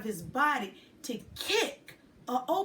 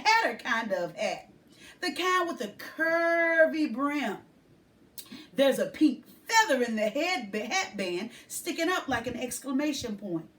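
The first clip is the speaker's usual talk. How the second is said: usual speaking voice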